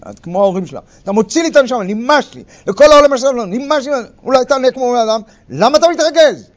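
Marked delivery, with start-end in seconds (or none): 1.0-5.2 loud and higher, fed-up yelling; furious outburst. Retold or imitated. Spouse shouting at partner mid-fight, loud and higher. 5.5-6.5 loud and higher, mocking innocence; needling taunt. Spouse baiting partner to lose his temper, loud taunting.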